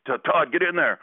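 retold shout from the coach; mock coach call. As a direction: bark it like a coach off the bench. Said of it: "I'm like".

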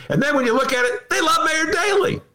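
rising to a high exasperated shout. Real, not acted. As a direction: build up and explode with disbelief. Ranting about loving mayors.

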